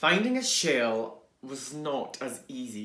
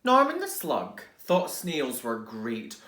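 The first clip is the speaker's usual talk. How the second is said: ordinary delivery, nothing marked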